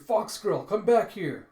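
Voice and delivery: high, loud summoning yell; calling out. Acted. Shouting down to the fox in the tomb, calling out. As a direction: holler after a runaway. Pursuer calls after fleeing fox.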